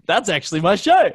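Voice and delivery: high, loud proud reveal; excited reveal. Retold or imitated. Telling the bartender it's his show, high and loud. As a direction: announce it with glee.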